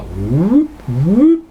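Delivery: two rising whoops; playful sound-effect whoop, swooping up; mock whoop. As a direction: slide each whoop upward. Acts out pulling hand back.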